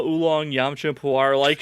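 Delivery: ordinary talk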